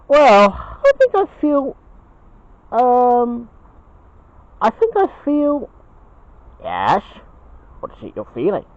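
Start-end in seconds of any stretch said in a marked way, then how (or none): 0.1-5.7 higher, slow, halting bear voice; Ben the Bear, hesitant. Acted. Ben hesitantly confides in his dad after their walk, high and halting. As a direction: draw it out high and unsure.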